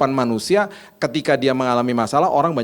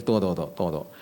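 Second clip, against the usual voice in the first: cautious interruption; 6 semitones lower, 7 dB quieter, much faster than usual. Retold or imitated. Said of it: "langsung gini".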